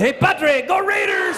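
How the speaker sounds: put-on high, loud Spanish-accented kid; mock Spanish accent, cheering shout. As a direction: call out as a Spanish-accented boy. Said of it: "pick up her accent".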